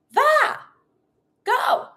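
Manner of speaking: very high one-word order; super energetic command. Shown as a demonstration. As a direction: send the pet off with gusto. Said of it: "We always try to be super energetic".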